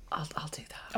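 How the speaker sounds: imitating a classmate in a whisper; near-whispered imitation. Host recalls a better classmate in a school group, whispered imitation. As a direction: whisper it as the one who takes over. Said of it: "and they were like".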